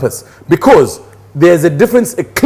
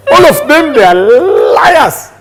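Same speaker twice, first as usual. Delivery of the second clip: mock outrage; 10 semitones higher, 4 dB louder than usual. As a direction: cry it out high, laughing through the accusation. Mock outburst accusing all women.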